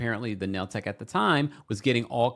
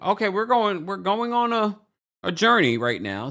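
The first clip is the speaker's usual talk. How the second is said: mock weary announcement; 10 semitones higher, 4 dB louder than usual. Viewer bracing the audience for a long story, mock weary. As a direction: sigh it out like settling in for a long ride. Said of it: "I was like".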